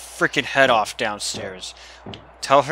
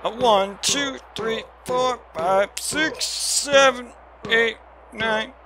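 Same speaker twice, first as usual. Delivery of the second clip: drawn-out count; 11 semitones higher, much slower than usual. Real, not acted.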